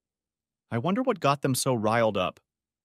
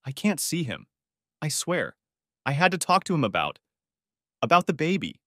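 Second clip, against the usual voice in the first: higher-pitched, mildly tearful pleading; a touch of crying in a raised pitch; 6 semitones higher than usual. A narrator's reading. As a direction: confess as if holding back tears. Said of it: "already crying".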